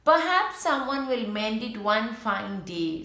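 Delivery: plain reading voice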